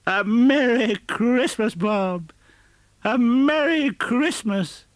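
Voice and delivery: very high, loud, slow hearty wish; earnest joyful greeting. Acted. Scrooge heartily wishing his clerk a merry Christmas, earnest joy. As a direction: beam it out from the heart. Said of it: "with an earnestness".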